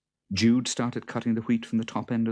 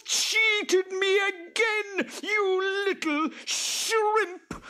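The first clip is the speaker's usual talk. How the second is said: high-pitched, drawn-out raging screech; furious creature shriek, far above the narrator's voice; screaming with rage; 5 dB louder, slower than usual. A narrator's reading. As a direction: screech it slowly like an enraged boggart. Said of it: "The boggart screamed with rage".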